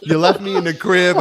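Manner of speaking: loud laughing mock grievance; laughing complaint. Real, not acted.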